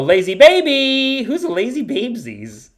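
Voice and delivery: put-on playful high baby-talk voice; cutesy baby talk. Cooing as if to a baby.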